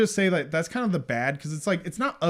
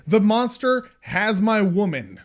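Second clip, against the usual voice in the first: passionate movie hero; 3 semitones higher, 8 dB louder than usual. Retold or imitated. Co-host acting out a hero rallying against the monster, passionate. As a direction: declaim it like an impassioned monster hunter. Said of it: "They're passionate. It's like".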